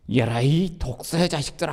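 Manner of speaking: a hint of a stern rebuke; mild prophet's rebuke; rebuking prophet. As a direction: scold them lightly as a prophet. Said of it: "책망을 합니다".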